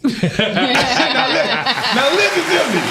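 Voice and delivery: high, laughing through the words; cracking up, trying to go on; high-pitched laughing aside. Real, not acted. Laughing after punchline.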